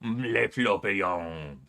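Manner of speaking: slow, lightly put-on French accent; mock French. Streamer names the character in mock French. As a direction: say it like a joking Frenchman. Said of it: "what French people sound like".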